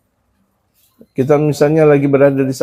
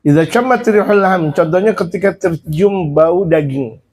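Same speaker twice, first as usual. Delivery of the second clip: ordinary talk, nothing marked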